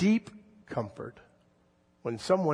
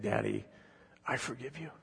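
softer, gently voicing a little child; small child's forgiveness; 2 semitones lower, 10 dB quieter than usual. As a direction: let it fade into a hushed child's voice. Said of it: "getting a little emotional".

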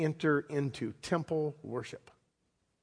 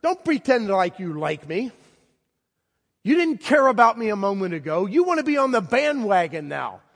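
louder, higher rising resentment; indignant retort; 6 semitones higher, 5 dB louder than usual. Pretend. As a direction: throw it back at the fake friends. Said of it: "if I was the blind man".

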